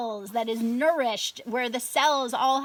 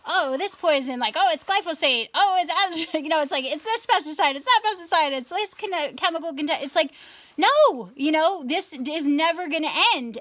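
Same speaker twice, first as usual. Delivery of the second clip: higher-pitched, exasperated rattling-off of one thing after another; 4 semitones higher, 4 dB louder than usual. Retold or imitated. Woman retelling her despair at endless poisons, exasperated. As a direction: act out being fed up with endless whack-a-mole. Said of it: "felt really disempowered".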